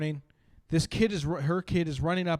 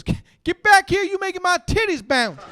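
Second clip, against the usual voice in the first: much higher, louder calling mom; mother calling her kid; 8 dB louder than usual. Retold or imitated. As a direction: holler after a runaway kid. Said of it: "impression of this woman".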